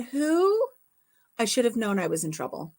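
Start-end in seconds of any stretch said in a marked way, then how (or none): 0.0-0.7 high mock who. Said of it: "as soon as he said".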